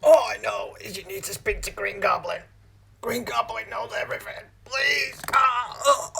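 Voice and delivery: panicked confession. Acted. Breaking under interrogation.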